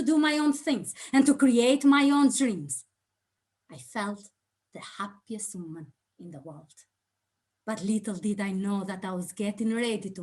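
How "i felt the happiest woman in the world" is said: whisper. Acted. Recalling her happiest moment.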